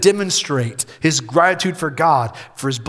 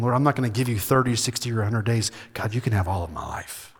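low, soft, brisk prayer of surrender; hushed prayer; 7 semitones lower, 7 dB quieter, faster than usual. Acted. Preacher models a vow to God for the congregation, hushed prayer. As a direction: pray it quietly, pitched well down, letting it sink softer.

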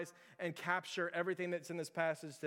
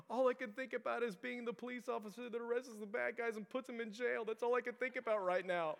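put-on high, tearful little-boy voice; mock crying child; 6 semitones higher than usual. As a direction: imitate a kid whining through tears. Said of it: "crying a little bit about it".

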